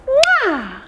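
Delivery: a high smacking air kiss; a playful kiss blown to the toddlers; kiss sound. Shown as a demonstration. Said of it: "Blow a little kiss".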